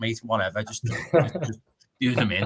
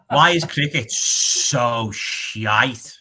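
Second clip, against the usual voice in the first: "Why is cricket so shite?" dragged out very slowly, louder, every word leaned on; heavy emphasis; 2 semitones higher, 6 dB louder, much slower than usual. Shown as a demonstration. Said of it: "real emphasis needed there".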